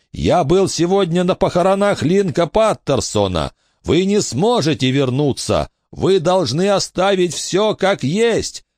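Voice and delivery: high insistent cry; urgent shout. A narrator's reading. Billy shouting that he saw the ghost's funeral. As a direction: press the truth on him.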